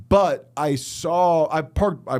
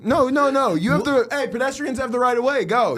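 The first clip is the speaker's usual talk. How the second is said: higher and louder, forcing the point; mock angry insistence; 8 semitones higher, 3 dB louder than usual. Pretending to yell at a pedestrian to cross, mock angry. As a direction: bark at them to just go.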